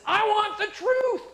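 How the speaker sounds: high, loud courtroom demand; Tom Cruise impression. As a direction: shout it like a young officer on the stand. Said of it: "and he says".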